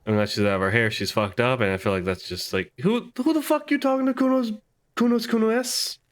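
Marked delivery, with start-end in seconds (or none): 2.8-6.0 very high put-on character voice; mock challenge. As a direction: squeak out a pretend indignant challenge.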